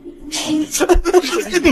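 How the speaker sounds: a high, airy ad-style laugh; bright jingle laugh. Acted. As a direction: laugh up high like a cheerful commercial. Ad spoof ending.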